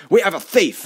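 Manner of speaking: loud, high American alarm; American accent. Acted. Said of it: "said in English with an American accent".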